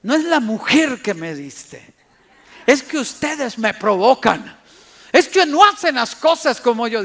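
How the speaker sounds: blaming man. Retold or imitated. Angry man excuses himself to his family, indignant. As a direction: shove the blame onto everyone else.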